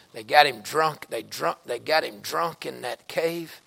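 low, soft, sober preaching; hushed sermon storytelling. Real, not acted. Preacher telling the church how Lot lost everything, hushed storytelling. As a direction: drop down low and let each phrase settle.

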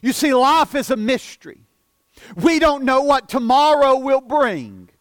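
ordinary talk, nothing marked

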